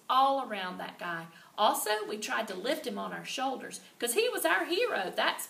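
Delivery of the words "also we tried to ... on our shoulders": usual speaking voice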